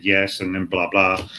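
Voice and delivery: usual speaking voice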